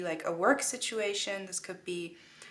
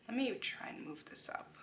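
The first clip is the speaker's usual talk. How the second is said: whispered aside to herself; whispering; 2 semitones higher, 12 dB quieter than usual. Real, not acted. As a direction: mutter it under the breath. Aside while handling cards.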